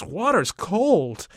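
high, drawn-out cry; child's scream. A narrator's reading. Tommy wading in the cold river, screaming out. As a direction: squeal it slowly like a shocked boy. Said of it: "Tommy screams".

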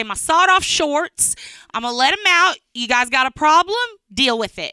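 brash Southern woman showing off her belly; hint of a sassy Southern lady. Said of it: "she talked like this".

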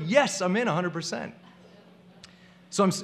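ordinary talk, nothing marked

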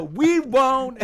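high, loud singsong shout; kids calling back. Retold or imitated. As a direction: holler back like children heading off. Children calling back to mother.

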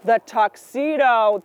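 usual speaking voice

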